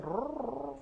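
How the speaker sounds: soft rolled "rrr" of a hungry belly; growling stomach imitation. Recalling childhood hunger to the church, soft belly growl.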